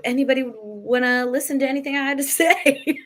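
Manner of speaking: breaking into a laugh on the last word. Real, not acted. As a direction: let a real laugh take over at the end. Laughs at her own self-doubt.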